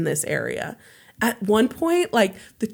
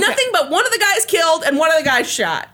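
incredulous laugh; 11 semitones higher, faster than usual. Real, not acted. Incredulous recap of failed robbery.

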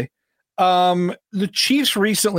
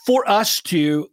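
ordinary talk, nothing marked

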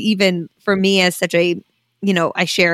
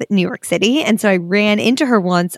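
ordinary talk, nothing marked

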